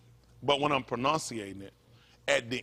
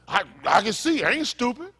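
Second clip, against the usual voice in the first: high, loud imitation of indignation; acted-out protest in a raised voice; mock protest; 10 semitones higher, 6 dB louder than usual. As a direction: play someone defensively insisting, pitched up. Voicing someone protesting their own sense.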